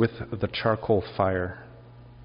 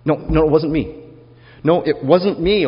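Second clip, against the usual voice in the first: higher and louder, a flustered no-no-no; panicked denial; 6 semitones higher, 8 dB louder than usual. Acted. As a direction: Peter scrambling to deny him. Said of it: "He goes".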